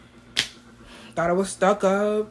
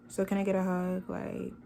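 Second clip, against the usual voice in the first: softer, a put-on voice for the date; mock date voice; 12 dB quieter than usual. Imitating her date asking for a hug, soft put-on voice. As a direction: lightly voice the guy asking for a hug.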